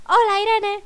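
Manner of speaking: high, loud hello; calling out greeting. Retold or imitated. Women from the village calling to Irene, bright loud greeting. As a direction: hail someone from behind.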